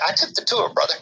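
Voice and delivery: ordinary talk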